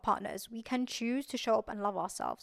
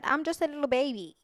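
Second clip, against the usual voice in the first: put-on a touch of a cutesy little-kid voice, higher and louder; playful baby voice; 4 semitones higher, 4 dB louder than usual. Host teasing herself about her age to viewers, in a cutesy baby voice. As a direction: playfully act tiny and young.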